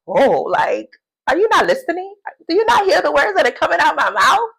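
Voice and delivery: high, incredulous comeback; exasperated snark. Retold or imitated. Jesus scolding a disciple, high and incredulous. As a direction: act like they never listen. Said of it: "a funny rebuttal".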